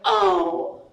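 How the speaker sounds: tiny, very high bewildered voice; wide-eyed innocent munchkin. Retold or imitated. Head munchkin asking why he is thirty, high innocent voice. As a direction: ask it high, like a little Bambi. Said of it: "looks up at me with his big Bambi eyes".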